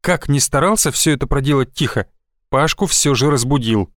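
ordinary delivery

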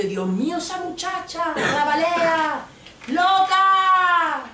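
high, loud, shocked street voice; scandalised passers-by. Retold or imitated. Passers-by gawk at the screaming girlfriend, shocked high voice. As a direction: gasp at the crazy girl. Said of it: "Y la gente".

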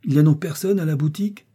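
loud call with a rising end; shouting. A narrator's reading. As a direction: shout for the landlord, half annoyed. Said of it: "cria l'homme au bandeau".

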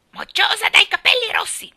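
high, loud contemptuous parrot screech; scornful parrot squawk. A narrator's reading. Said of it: "con tono di disprezzo profondo". (strillo sprezzante di pappagallo)

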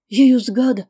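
loud, high startled cry; cri d'alarme. A narrator's reading. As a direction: grandmother crying out in alarm. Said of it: "cria bonne maman". (cri de grand-mère, fort et aigu)

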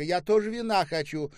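loud, high-pitched clamour; demanding shout. A narrator's reading. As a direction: clamour for your share. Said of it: "закричала Ольга". (капризно выкрикивая)

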